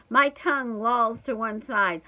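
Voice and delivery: plain reading voice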